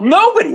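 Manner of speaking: Nobody jumps way up in pitch; indignant emphasis. Real, not acted. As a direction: hit Nobody high and hard.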